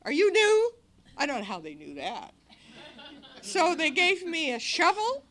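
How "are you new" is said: high, loud quizzical question; lightly voiced old-timer asking; mock surprise. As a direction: ask the newcomer teasingly. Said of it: "they said".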